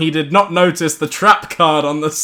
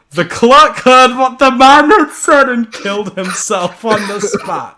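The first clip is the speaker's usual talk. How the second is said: hammed-up shout; 5 semitones higher, 4 dB louder than usual. Narrator hams up the death.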